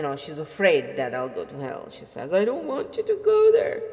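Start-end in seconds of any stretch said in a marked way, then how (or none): 2.3-3.8 high, louder pleading mother voice; shrill-pitched mother imitation, much higher; imitating mom. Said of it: "This is how she talks to me".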